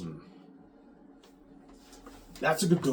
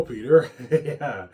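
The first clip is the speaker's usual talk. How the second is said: ordinary delivery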